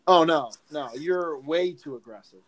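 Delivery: usual speaking voice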